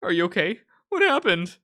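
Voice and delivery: high loud worried cries; frantic, checking on a friend; panicked. Acted.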